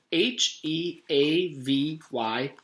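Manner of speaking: slow letter-by-letter spelling. Acted. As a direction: spell each letter carefully.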